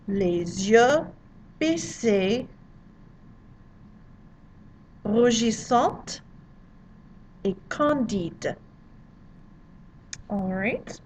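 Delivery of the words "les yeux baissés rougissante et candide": "Les yeux baissés, rougissante et candide" said slowly in French with a throaty R; slow French recitation. Shown as a demonstration. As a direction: read the line once more as a careful model. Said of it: "that first line is".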